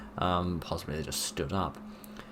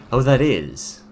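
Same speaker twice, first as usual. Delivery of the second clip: sudden loud high recoil; loud, high shocked oh; startled disgust; 6 semitones higher, 10 dB louder, slower than usual. Real, not acted. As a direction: jolt at the sight. Seeing the eyeball face.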